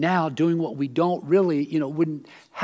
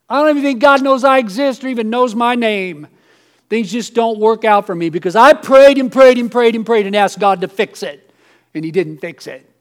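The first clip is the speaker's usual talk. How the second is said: louder, higher, rising self-pity; mock complaint; 5 semitones higher, 11 dB louder than usual. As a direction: rant like one who feels unheard by God. Voicing a bitter complainer.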